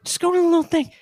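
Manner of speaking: high, pleading mock outrage; mock exasperated rant. Co-host badgering listeners to leave stars, mock-exasperated.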